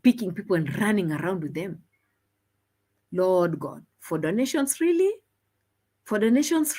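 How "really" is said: incredulous. Real, not acted. Mocking donations.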